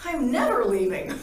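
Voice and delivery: playful high protest with a laugh; arguing back. Retold or imitated. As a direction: dig your heels in, half laughing. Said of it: "I kept arguing".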